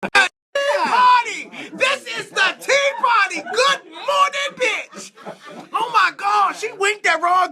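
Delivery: high, loud whoops through laughter; rowdy hooting. Real, not acted. Host hollering at the guest's wink, rowdy and laughing. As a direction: holler it with drawn-out words, cracking up.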